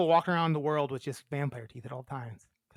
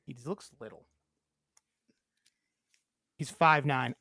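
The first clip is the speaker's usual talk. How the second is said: ordinary talk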